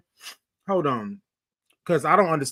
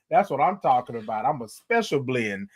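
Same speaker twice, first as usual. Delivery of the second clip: ordinary talk, nothing marked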